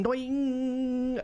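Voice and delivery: high, stretched-out "doing" like a gauge hitting the top; meter sound effect. Pretend. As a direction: sing the meter needle shooting way up. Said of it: "just winding up the Millsy meter".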